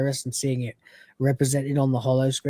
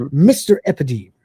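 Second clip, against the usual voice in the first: mock respectful; 6 dB louder than usual. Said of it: "respectfully talking to it with the honorifics".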